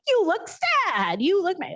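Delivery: put-on name the feeling big, like to a toddler; exaggerated toddler mirroring. Doctor mirroring her toddler's feelings, high and exaggerated. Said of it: "It's like".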